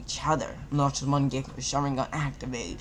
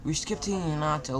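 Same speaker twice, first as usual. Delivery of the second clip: usual speaking voice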